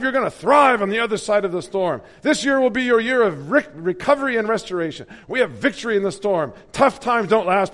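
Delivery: loud, high rally preacher imitation; Boshoff impression. Mocking the Boshoff preacher to the congregation, loud and high. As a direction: preach it like a stadium hype man.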